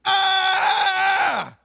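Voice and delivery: loud, high retold scream of "Ah!". Pastor reenacting his friend's scream in church, loud high scream. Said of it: "he starts to scream".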